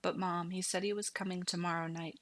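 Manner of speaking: plain reading voice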